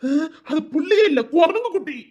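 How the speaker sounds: high, whiny mock crying; mock whine. An assistant mock-crying about the teacher's naughty kid. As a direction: whimper it in comic despair.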